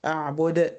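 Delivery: أعبد with the final د bounced; qalqala. Shown as a demonstration. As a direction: release the د of أعبد with a small echo. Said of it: "هي دي القلقلة". (قلقلة دال أعبد)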